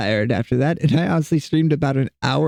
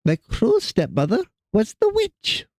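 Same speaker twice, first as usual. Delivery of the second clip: wicked stepmother; 7 semitones higher than usual. Shown as a demonstration. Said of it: "I don't know where that voice came from".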